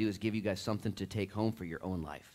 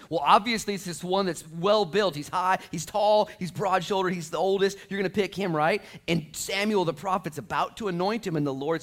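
high, loud, driving preacher voice; full-force preaching; 10 semitones higher, 9 dB louder than usual. Real, not acted. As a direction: pile up the list with rising force.

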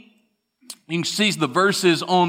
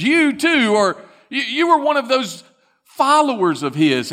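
high, louder pointed accusation; second accuser; 8 semitones higher, 4 dB louder than usual. Acted. Bystander accusing Peter at the fire, high and loud. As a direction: jab the finger with your voice, up high.

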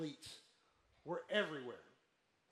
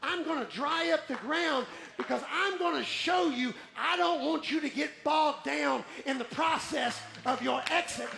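loud, high, driving sermon voice; impassioned pulpit delivery; full-force preaching; 6 semitones higher, 9 dB louder than usual. Real, not acted. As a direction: preach it hard, building to a shouted climax.

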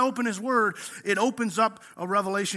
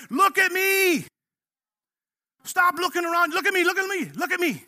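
loud and high, calling to be looked at; God calling out; 8 semitones higher, 4 dB louder than usual. Retold or imitated. God calling to his child, a loud high insistent call. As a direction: call out insistently, louder and higher. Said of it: "He's the one who says".

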